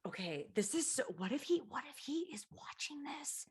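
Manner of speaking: hushed, secretive whisper; barely voiced, conspiratorial aside. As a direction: whisper as if he might be listening. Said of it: "Should I whisper?".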